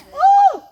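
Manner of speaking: high, loud imitated whoop; stomp dance holler. Retelling the call from the woods, a loud high whoop. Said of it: "holler out and go".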